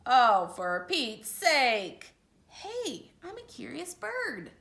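a fed-up bird losing patience; a touch of a cranky pigeon; frazzled pigeon. Acted. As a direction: pigeon boiling over at the duckling. Reads the Pigeon's part.

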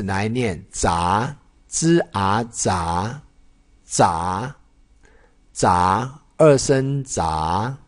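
吱啊杂 slowly blended, then 二声杂 with a rising second tone; slow syllable drill. Shown as a demonstration. Teacher showing students how to read 杂, slowly. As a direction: break 杂 into 吱 and 啊, then rise on 杂. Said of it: "再跟我念一次".

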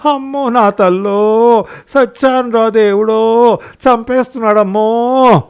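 wife wailing to the whole bus; wailing cries. Acted. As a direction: howl for help as if being killed. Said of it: "కేకలు పెడుతూ గొడవ గొడవ".

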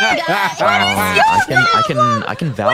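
high loud shout. Real, not acted. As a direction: yell it over the others. Shouting over friends.

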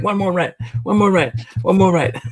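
high-pitched mock begging; imitated pleading partner. Partner begging to stay at the park, high mock plea. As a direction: mimic her in a high, eager singsong. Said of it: "She's like".

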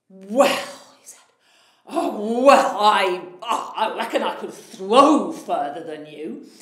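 a big proud giant sizing up a tiny man; boastful giant. Acted. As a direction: giant challenging the little tailor. Flustered giant tries again.

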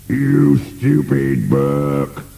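very slow, high-pitched monster rant. A narrator's reading. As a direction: scold the servant, stretching every word. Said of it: "As him upstairs ranted on".

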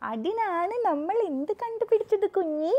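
slow sing-song question. Real, not acted. Asking baby.

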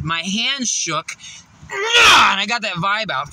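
slow then bursting loud; building to a shout. Real, not acted.

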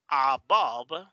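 "above" said slowly, each sound drawn out; careful pronunciation. Shown as a demonstration. Teacher showing learners how to pronounce it, careful pronunciation. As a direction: model "above" clearly with a drawn-out stressed vowel. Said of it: "te va a enseñar a pronunciarlo bien".

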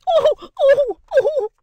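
very high, loud shrieked ohs; repeated piercing high shrieks. A narrator's reading. Sister works herself into passion, shrieking. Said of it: "Each of these exclamations was a shriek".

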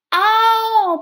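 high, loud roaring cry; loud calling roar; dinosaur roar. Acted. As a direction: roar out to call a friend. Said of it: "霸王龙大声吼叫".

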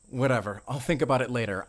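ordinary delivery

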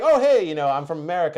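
high, loud, overeager American tourist; mock eager self. Telling the shaman where he's from, mock eager. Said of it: "This is an mimic of him".

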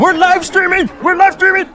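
high-pitched, repeated hype call; excited announcement. Real, not acted. Streamer hypes the live show, excited high call. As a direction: crow it to the viewers.

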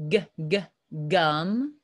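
"g, g" as short separate hard sounds, then "gum"; sounding out. Shown as a demonstration. Teacher checking how gum starts, sounded out. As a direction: repeat the first sound of "gum", then the word. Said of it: "That's a G sound".

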